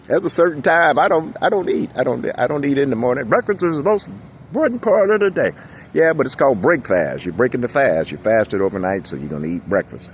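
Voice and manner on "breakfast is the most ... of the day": mock lecturing. Quoting common saying.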